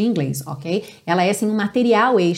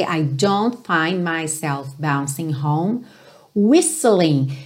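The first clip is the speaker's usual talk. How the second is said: linked phrase very slowly; slow demo; 5 semitones lower, much slower than usual. Shown as a demonstration. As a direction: take it slowly for learners. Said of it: "vai praticando devagarzinho". (bem devagarzinho)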